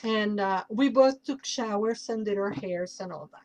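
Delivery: normal talking voice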